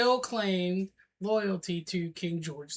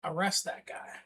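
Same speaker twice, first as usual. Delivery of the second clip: pointing accuser; 4 semitones higher, 4 dB louder than usual. Acted. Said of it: "just point and say".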